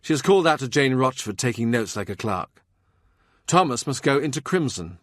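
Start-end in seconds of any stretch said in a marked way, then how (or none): none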